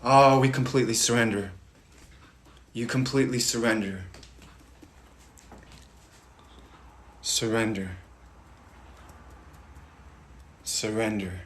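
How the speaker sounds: from normal down to soft; quietly fading guidance; fading meditation. Acted. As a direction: let the voice sink into surrender.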